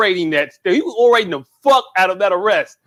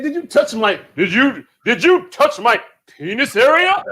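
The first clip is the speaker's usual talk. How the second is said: orating British arrestee; 4 semitones higher than usual. Retold or imitated. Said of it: "he was rolling R's".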